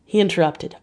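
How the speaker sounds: ordinary delivery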